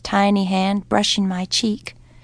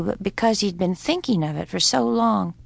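ordinary delivery

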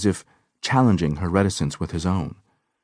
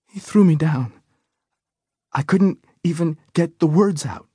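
higher, shaken panicky telling; a touch of remembered panic; retroactive panic; 10 semitones higher, 4 dB louder than usual. A narrator's reading. Injured woman telling the doctor, lingering panic. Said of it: "Her voice was smoky and deep".

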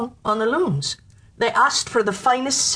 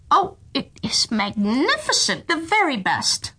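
flustered praise; 5 semitones higher than usual. Acted. Minister pretends to admire cloth.

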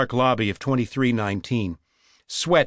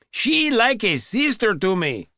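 put-on high, sing-out Spanish accent; syrupy Spanish accent; 9 semitones higher than usual. A narrator's reading. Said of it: "sang out in a syrupy Spanish accent".

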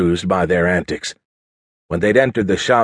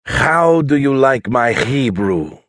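put-on slow, higher-pitched Hebrew accent with rough H's; exaggerated Hebrew accent; 4 semitones higher, slower than usual. Husband showing off to his wife, exaggerated Hebrew accent. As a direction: lay on a thick Hebrew accent, rasping the H. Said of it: "exaggerating the rough H sound".

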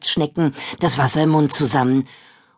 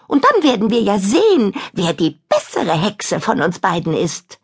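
high boastful witch; 7 semitones higher than usual. Acted. Kadabra boasting.

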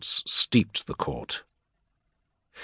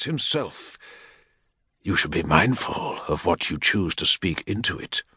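lightly voiced warlord's aide, measured threat; menacing equerry; 4 semitones higher than usual. Equerry rebuking a petitioner, lightly voiced menace.

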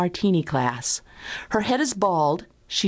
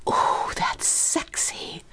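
slow, breath-only sultry whisper; aroused caller's whisper; much slower than usual. A narrator's reading. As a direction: whisper it slowly, turned on. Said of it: "my caller whispered".

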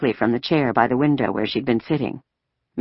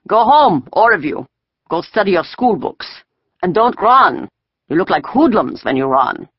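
loud, higher-pitched orders; commanding soldier; 5 semitones higher, 5 dB louder than usual. A narrator's reading. Soldier sending schoolgirls home, commanding. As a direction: order the children off in short commands.

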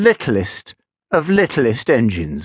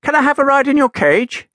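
high, eager little engine plea; hopeful little engine; 12 semitones higher, 4 dB louder than usual. Acted. Little engine asking Gideon for a ride, high and hopeful. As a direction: lift the pitch and ask hopefully. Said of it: "looked up at Gideon hopefully".

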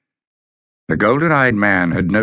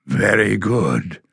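slow, even monotone; no change of tone; slower than usual. A narrator's reading. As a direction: say it without any feeling. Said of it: "with no change of tone".

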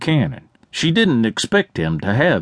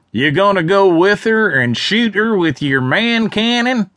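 higher, jeering heckler voice; mocking call; 7 semitones higher than usual. A narrator's reading. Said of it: "someone else called out".

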